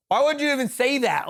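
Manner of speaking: mock-exasperated comeback; indignant. Retells indignant reply to trooper.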